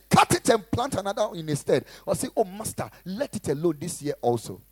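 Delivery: loud, driving sermon delivery; preaching. Acted. Pastor preaching loudly to his church. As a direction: preach it hard to the congregation.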